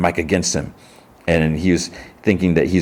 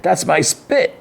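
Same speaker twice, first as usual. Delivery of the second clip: very high, hammed-up delight; mock excited; 13 semitones higher, slower than usual. [name] claims the song as his, high mock excitement. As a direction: imitate a thrilled cry in a high voice.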